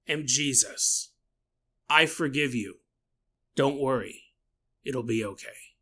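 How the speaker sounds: lightly voiced calm man; a hint of a soothing stranger; man's voice. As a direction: hidden voice comforting the sobbing woman. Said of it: "second male voice say".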